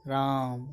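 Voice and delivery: राम with its vowel held three times as long; drawn-out pluta vowel. Shown as a demonstration. Teacher shows a pluta vowel on राम to students, long held vowel. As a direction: stretch the vowel of राम like a distant call. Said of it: "इसका हे".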